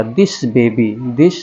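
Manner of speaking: ordinary talk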